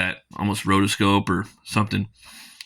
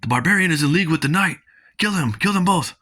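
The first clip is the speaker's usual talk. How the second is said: higher, fast commanding shout; rally the mob to kill; mock villain barking orders; angry order; 8 semitones higher than usual. Enemy orders attack on heroes.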